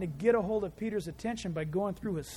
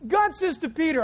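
ordinary talk, nothing marked

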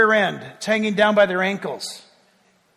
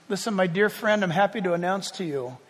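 normal talking voice

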